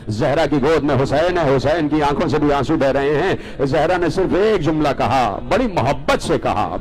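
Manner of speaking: ordinary talk, nothing marked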